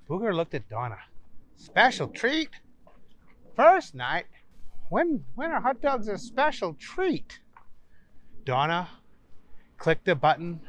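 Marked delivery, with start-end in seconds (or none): 1.6-7.4 high, incredulous questions; can't believe what he hears; puzzled disbelief. Acted. Booger in disbelief.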